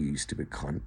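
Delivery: dropped low, under the breath; muttered insult. Real, not acted. Ranting vlogger mutters insult at a staring adult. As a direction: spit it out low and soft.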